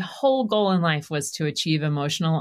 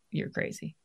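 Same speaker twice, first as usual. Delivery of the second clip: whispered retort; 11 dB quieter than usual. Retold or imitated. Wife arguing with husband at bedtime.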